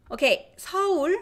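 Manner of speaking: Seoul said as two syllables, high and clear; correct Seoul. Shown as a demonstration. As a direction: say Seoul with a pure o, not ow. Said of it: "this is how you pronounce".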